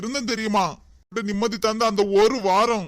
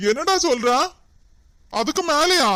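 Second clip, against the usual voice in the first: high, louder stunned question; can't believe what he heard; surprised; 5 semitones higher, 3 dB louder than usual. Acted. Friend stunned by the strange compliment, surprised.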